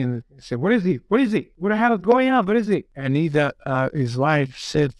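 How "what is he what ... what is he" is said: retold yelling. Brother bursting in to demand who the visitor is, mock yelling. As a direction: fire off startled questions in a raised pitch. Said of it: "he started yelling".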